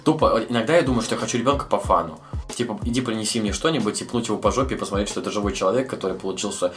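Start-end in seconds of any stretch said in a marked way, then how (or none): none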